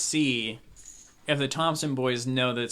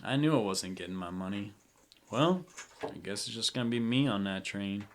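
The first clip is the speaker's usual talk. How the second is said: low, soft resigned grumble; 5 semitones lower, 6 dB quieter than usual. Acted. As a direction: shrug it off, disappointed. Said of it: "and he goes".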